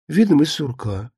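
plain reading voice

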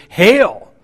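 a big loud high shout of greeting; exclamation. Shown as a demonstration. Preacher voicing the thrilled angel Gabriel, exclaiming loudly. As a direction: burst in with the news, shouting. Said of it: "with exclamation".

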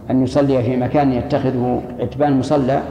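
ordinary talk

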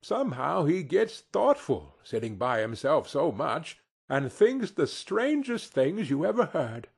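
a touch of tender trembling; tremulous. A narrator's reading. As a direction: tell it with restrained emotion, voice lifted. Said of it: "Bob's voice was tremulous when he told them this".

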